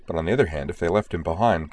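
ordinary delivery

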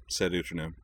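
ordinary delivery

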